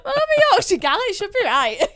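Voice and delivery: high, loud giggly protest; laughing. Real, not acted. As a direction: laugh through the words as a Yorkshire gal. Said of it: "laughing at accent teasing".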